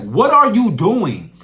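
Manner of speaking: loud, slow startled question. Retold or imitated. Counselor startled by kid at his legs, loud slow question. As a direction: bark it at the kid underfoot.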